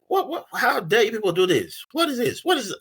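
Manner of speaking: high-pitched mock yell, little added volume; retold yelling. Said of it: "and I'm yelling".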